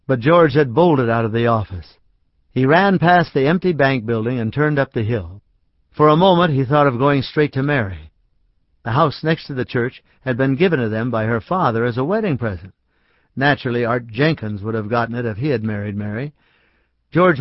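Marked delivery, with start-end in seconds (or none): none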